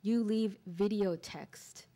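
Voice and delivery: slow, measured poem recital with pauses between images; poetry reading. Acted. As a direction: read each line deliberately, letting it land.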